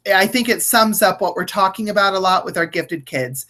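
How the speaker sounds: normal talking voice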